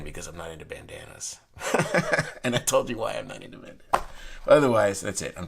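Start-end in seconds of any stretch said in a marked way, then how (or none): 1.4-2.4 a quick burst of laughter; short high laugh. Real, not acted. Joking to viewers about bandanas, laughing. 2.4-4.1 amused, words riding a laugh. Real, not acted. Teasing viewers about bandanas, laughing.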